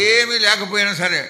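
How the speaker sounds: "ఏమీ లేకపోయినా సరే" punched high, loud and fast; emphatic reading. Shown as a demonstration. Preacher showing the interpreter how to read the verse, with strong emphasis. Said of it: "you should read the emphasis".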